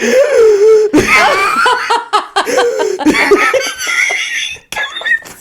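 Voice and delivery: loud, high, drawn-out gasping, half laughing; acted gasping. Passenger collapsed on the train floor, gasping for breath. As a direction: imitate desperate gasps for air, hammed up. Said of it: "I'm literally like".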